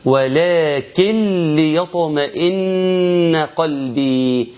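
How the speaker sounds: "ولكن ليطمئن" recited slowly, noon dropped, doubled lam; Quranic idgham. Shown as a demonstration. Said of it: "اسمع مني". (ترتيل بطيء بإدغام النون في اللام)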